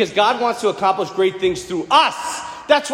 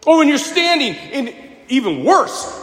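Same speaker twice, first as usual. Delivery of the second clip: normal talking voice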